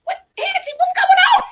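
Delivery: high-pitched startled shout at Angie; shocked outcry. Retold or imitated. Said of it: "I was like".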